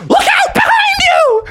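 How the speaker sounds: high, loud scream of warning. Retold or imitated. Froggy screaming a warning at the book in class. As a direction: yell the warning at the dragon's enemy. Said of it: "Froggy screamed out".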